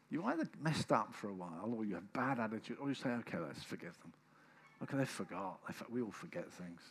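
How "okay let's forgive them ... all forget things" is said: lower, softer, letting it go; quiet, mild forgiving tone; gentle forgiving voice. Retold or imitated. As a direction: soothe yourself into forgiving. Voicing the gracious choice.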